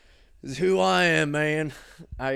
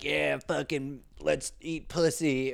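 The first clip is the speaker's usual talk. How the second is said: mock horny guy; 4 semitones higher, slower than usual. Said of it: "we didn't get it to be like".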